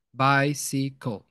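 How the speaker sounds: very slow bicycle, each syllable spaced: bi-cy-cle. Shown as a demonstration. Teacher spacing out syllables for students, very slow. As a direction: stretch bicycle out, one syllable at a time. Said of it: "o espaço da, das sílabas".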